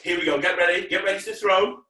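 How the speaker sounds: ordinary delivery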